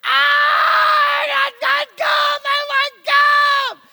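very high, loud tantrum shriek; shrieking toddler tantrum, slow and drawn out; screaming child. Acted. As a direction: scream like a kid losing it. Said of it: "Tommy screams bloody murder".

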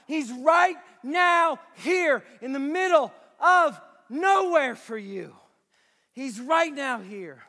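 fervent preacher building to shouts; preaching. Acted. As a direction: preach it with full conviction. Preaching to congregation.

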